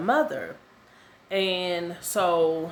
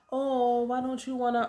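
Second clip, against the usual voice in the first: higher-pitched nurse voice, lightly put on; retold nurse's question, pitched up; imitated nurse; 6 semitones higher than usual. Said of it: "Jamaican or African, whichever one".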